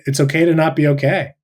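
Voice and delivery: ordinary delivery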